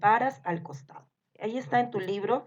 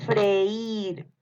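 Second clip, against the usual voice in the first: drawn-out "Freír"; dictation. Shown as a demonstration. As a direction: stretch the e and i of "Freír" in one breath. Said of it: "les voy a ir dictando". (dictado alargando "Freír")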